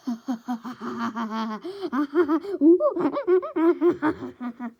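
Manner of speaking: loud, high evil cackle; maniacal villain laugh. Acted. All For One laughs maniacally at his target. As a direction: laugh like a madman. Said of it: "begins to grin maniacally and laugh".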